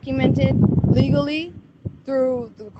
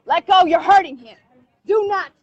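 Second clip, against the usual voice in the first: raised voice; 3 semitones higher, 3 dB louder than usual. Real, not acted. Mother ordering them to let go of her son, voice raised. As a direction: voice jumps up, ordering them to stop. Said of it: "Yes, my voice went up".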